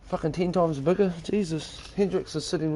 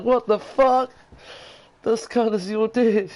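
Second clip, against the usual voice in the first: higher, louder retold disbelief; incredulous mock shock; 5 semitones higher, 3 dB louder, slower than usual. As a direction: act out a stunned onlooker, voice jumping up.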